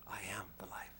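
breathy whisper of "I am the life.". Acted. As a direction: whisper it like a secret revelation. Repeating Jesus' words, hushed.

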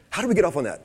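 ordinary talk, nothing marked

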